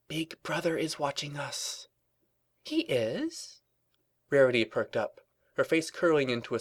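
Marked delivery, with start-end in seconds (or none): 0.1-1.9 hushed. Acted. Twilight whispers conspiratorially. 2.6-3.6 high prim lady voice. Acted. Rarity perks up.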